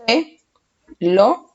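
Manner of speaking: "Pe" and "lo" as separate syllables with a pause. Shown as a demonstration. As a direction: sound out "Pe... lo", each lifted at the end. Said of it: "Separamos en sílabas".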